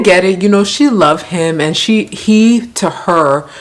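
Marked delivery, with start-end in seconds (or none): none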